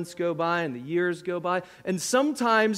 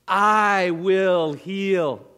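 "I" hit hard, very slow, louder; slow emphatic quote; 7 dB louder, much slower than usual. Shown as a demonstration. Preacher quoting the Lord to the church, slow and emphatic. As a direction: land each word of "I will heal", opening on "I". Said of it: "the Lord says".

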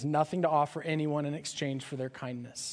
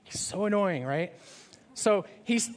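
ordinary delivery

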